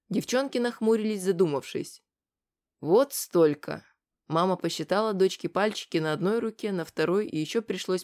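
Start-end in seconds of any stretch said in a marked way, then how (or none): 2.8-3.8 put-on drawn out for a little girl; reading to a toddler. Mother shows daughter with fingers.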